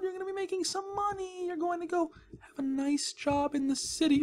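put-on talking to a villager like a toddler; baby talk. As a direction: dangle a treat in a sweet voice. Luring villager with an emerald.

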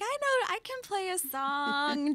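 high, drawn-out mock enthusiasm; hammed-up "look at me" college kid; mock eager show-off. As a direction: pipe up eagerly, stretching the words as a self-teasing quote. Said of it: "where I'd be like".

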